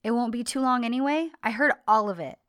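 hurt angry accusation. Retold or imitated. John accusing Sanem of planning the breakup, loud and bitter. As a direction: throw her own words back at her. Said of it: "And he says".

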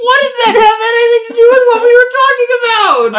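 high, loud and fast, thrown by the tangent; baffled outburst. Real, not acted. Co-host baffled by the sudden movie tangent, high and loud outburst. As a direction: demand to know what that has to do with anything.

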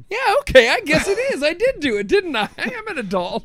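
high-pitched gushing, easing down; mock bashful pride. Retelling reply to visitor's compliment, high-pitched playful pride. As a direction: act out a flustered, delighted reply.

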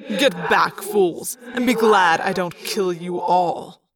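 slow, sneering villain threat. A narrator's reading. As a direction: sneer down at underlings. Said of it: "a sneering, Thoughtspeak voice said".

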